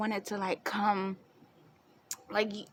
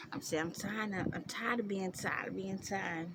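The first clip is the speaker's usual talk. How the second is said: really quiet; 8 dB quieter than usual. Real, not acted. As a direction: keep it down, barely projecting. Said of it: "if I'm really quiet".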